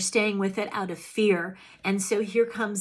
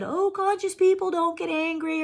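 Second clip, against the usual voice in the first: mocking; 8 semitones higher than usual. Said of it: "I'm not gonna spiritually bypass that".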